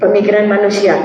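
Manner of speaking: ordinary talk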